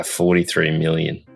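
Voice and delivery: normal talking voice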